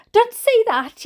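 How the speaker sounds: high, loud yell of protest; mother's yell. A narrator's reading. Mother snapping at dad in the rush, yelling. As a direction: yell it, pitched right up. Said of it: "yelled my mother".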